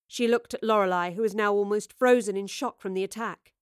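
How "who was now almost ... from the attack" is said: usual speaking voice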